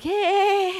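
high, loud drawn-out whoop; retold concert shout; cheering. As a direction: cheer like a fan at the front.

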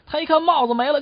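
ordinary talk

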